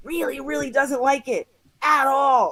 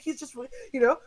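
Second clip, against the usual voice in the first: ordinary talk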